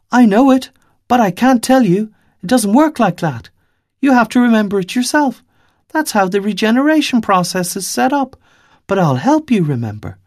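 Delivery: put-on a child laying down the rules; young girl's voice. Little girl refusing to tell Five his name, high and firm.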